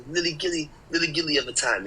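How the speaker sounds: a hint of a posh Southern Brit; posh Southern English accent. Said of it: "the accent is posh".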